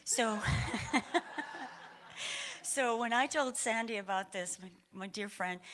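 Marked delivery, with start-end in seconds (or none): 0.4-2.6 long, high airy laugh. Real, not acted. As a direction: laugh fondly about your friend. Amused while addressing her friend.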